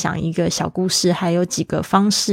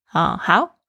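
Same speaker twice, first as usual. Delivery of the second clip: ordinary talk, nothing marked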